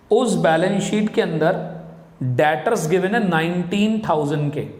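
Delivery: normal talking voice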